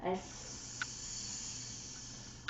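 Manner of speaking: long soft hiss of air; truck tire hiss. Shown as a demonstration. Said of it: "like a truck tire letting out air".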